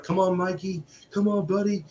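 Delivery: high-pitched coaxing from the buddies; cheering him on. Retold or imitated. Crowd of friends urging Mikey on.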